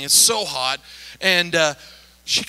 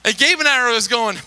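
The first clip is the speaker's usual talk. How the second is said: usual speaking voice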